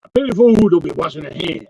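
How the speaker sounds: lightly voiced Mister T, tough-guy swagger; a hint of Mister T scolding the fool; Mister T impression. Host jokes as Mister T about spitting, lightly voiced tough guy. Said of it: "So Mister T is involved now".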